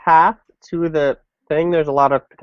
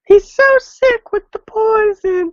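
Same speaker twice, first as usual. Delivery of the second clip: put-on much higher, slow girl voice; slow falsetto girl lamenting; 3 dB louder, slower than usual. Shown as a demonstration. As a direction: pitch it up like a young girl. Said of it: "what was that?".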